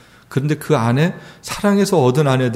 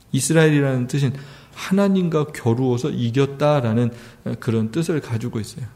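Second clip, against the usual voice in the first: ordinary delivery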